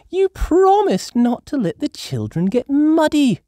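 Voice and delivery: high, loud exasperated; Mummy Pig crying out. A narrator's reading. Mummy Pig scolding the grandparents about mud. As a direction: protest at muddy children. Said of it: "cries Mummy Pig".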